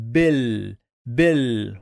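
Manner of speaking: BIL cut off on the l; first syllable alone. Shown as a demonstration. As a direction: stop on the l of bil. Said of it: "So the first syllable is".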